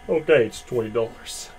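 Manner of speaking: ordinary delivery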